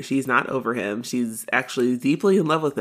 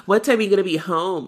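quick, higher-pitched nagging call; mock yell; 6 semitones higher, faster than usual. Mocking Heather calling after her sister, quick mock yell. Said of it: "yells after Erica".

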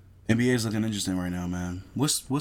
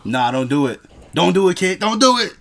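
rising louder with each plea; loud warning, building; 7 semitones higher, 11 dB louder than usual. Real, not acted. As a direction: get louder and higher each time. Urging friend against food.

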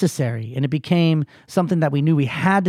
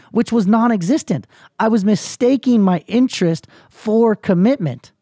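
higher, emphatic retold epiphany; impassioned realisation; 6 semitones higher, 3 dB louder than usual. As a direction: drive it home like a sudden revelation. Retold realisation, blaming himself.

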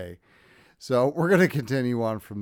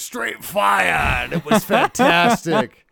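hyped-up; 8 semitones higher, 8 dB louder, slower than usual. Pretend. Hyping the episode.

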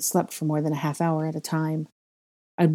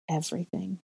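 hushed echo; 10 dB quieter than usual. A narrator's reading. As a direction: drop to a near-whisper, softer. Echoed word after a long pause.

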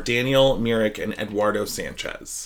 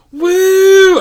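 loud, high-pitched "woo"; long, high cheer; celebratory whoop; 14 dB louder than usual. Real, not acted. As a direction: whoop it up for the guest. Cheering the special guest's introduction.

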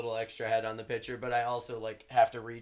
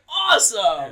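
higher, louder mock enthusiasm; sarcastic awesome; 9 semitones higher, 12 dB louder, slower than usual. Bartender mocks hearing the festival is moving, sarcastic. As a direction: fake cheer, clearly sarcastic. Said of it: "And I'm like".

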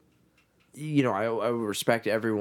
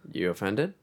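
ordinary talk, nothing marked

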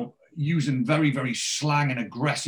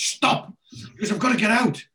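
panicked; 9 semitones higher than usual. Retold or imitated. Moxie panicking.

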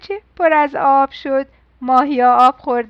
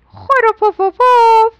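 higher, louder, slow snore; 8 semitones higher, 8 dB louder, slower than usual. A narrator's reading. Everyone asleep, storyteller snoring loud and slow.

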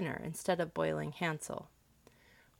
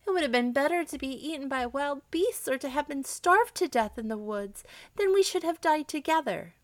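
put-on high-pitched, grieving little girl; storybook child in despair; tearful exclamation; 7 semitones higher than usual. A narrator's reading. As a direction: lament it in a raised, plaintive pitch. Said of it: "as the tears rolled down her cheeks, she exclaimed".

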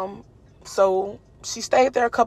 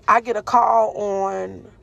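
ordinary delivery

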